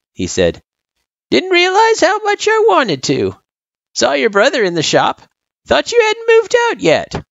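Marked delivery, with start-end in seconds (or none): 1.3-7.2 put-on high-pitched old-timer voice; old turtle, chatty. A narrator's reading.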